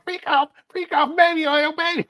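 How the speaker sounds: high, leering singsong; mock pervert cooing to a pet bird; creepy guy voice. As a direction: ham up a creep luring a parrot.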